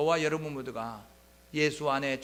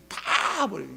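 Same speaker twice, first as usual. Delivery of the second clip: boastful vow; 7 semitones higher, 4 dB louder, much slower than usual. Retold or imitated. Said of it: "큰 소리로 예수님 앞에서 호언장담".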